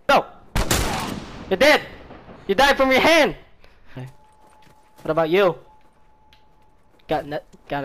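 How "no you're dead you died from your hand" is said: excited yell. Real, not acted. Exclaims over a kill in game.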